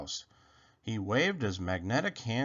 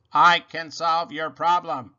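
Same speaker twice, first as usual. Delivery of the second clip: louder robot shout; 3 semitones higher, 8 dB louder than usual. Acted. Robot shouting to calm Rabbit, loud robot voice. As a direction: call it out over the noise. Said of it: "he shouted".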